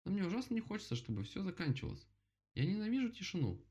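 put-on high childish bear voice; bear character voice. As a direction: plead like a small bear cub. Bear voiced in bedtime story.

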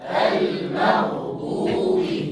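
المغضوب whispered very slowly; whispered drill. Shown as a demonstration. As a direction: breathe المغضوب softly. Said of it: "قولوها معي".

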